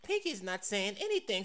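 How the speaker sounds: usual speaking voice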